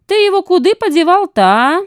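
high, loud wheedling swindler; shrill-voiced hunchbacked con man. A narrator's reading. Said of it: "голосом незадачливого мошенника-горбуна".

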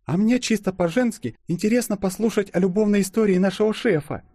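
high female character voice; supportive girlfriend. A narrator's reading. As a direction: chime in high, backing your friend. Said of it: "неожиданно поддержала Юлю подруга". (подруга поддакивает высоким голосом)

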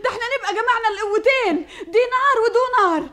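excited. Acted. Mother thrilled by news.

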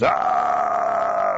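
low, loud, drawn-out "aah"; bear's scream. A narrator's reading. Bear gets hit in the bottom, low scream. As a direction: bellow low like a startled bear. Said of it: "Bear screams".

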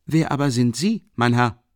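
high, loud and boisterous, a big clumsy student; hearty booming Rasumichin. Acted. Rasumichin ducking into the tiny room, loud and hearty. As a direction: fill the cramped room with your voice.

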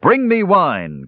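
high, drawn-out merry call; reveler's cry. A narrator's reading. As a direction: call slowly and high for the party. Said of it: "cried Sturm".

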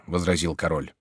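ordinary delivery

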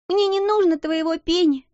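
put-on high childish sob-voice; sobbing child. A narrator's reading. Crying girl refusing a friend's penny, sobbing. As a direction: blubber it like a little girl. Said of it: "прорыдала Лотти".

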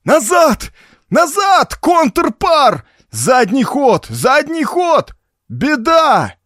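high, loud frantic yell to reverse; hoarse desperate shouting. A narrator's reading. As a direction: scream the orders hoarse and frantic. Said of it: "надрывно, неистово кричит охрипшим голосом".

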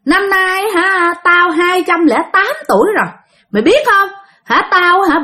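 high, boastful spirit voice; possessing spirit. Retold or imitated. Spirit naming itself to the mother, high possessed voice. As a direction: announce yourself as an old spirit, pitched high. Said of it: "là cô Út mới trả lời".

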